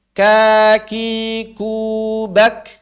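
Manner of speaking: Kaki kubak drawn out very slowly; slow model recitation. Shown as a demonstration. (contoh bacaan pelan)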